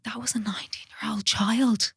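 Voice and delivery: hushed whisper of realisation. Retold or imitated. Telling herself the truth about her younger self, whispered. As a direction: breathe it out in a whisper.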